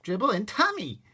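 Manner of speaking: put-on high, loud little-kid voice; toddler voice. Toddler repeating to his brother, kid voice. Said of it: "he repeated".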